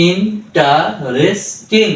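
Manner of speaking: Interesting drawn out slowly, syllables joined; slow blended word. Shown as a demonstration. Tutor joins the drilled syllables for learners, slowly. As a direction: stretch interesting out slowly, joining ইন, টা, রেস, টিং. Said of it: "তাহলে আমরা এখানে ভেঙেছি".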